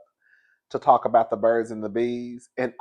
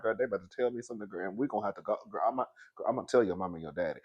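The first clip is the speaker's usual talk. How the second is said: fast, much softer inner monologue; inner thought; 7 semitones lower, 12 dB quieter, much faster than usual. Retold or imitated. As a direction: rush it under your breath. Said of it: "in my head, I'm thinking".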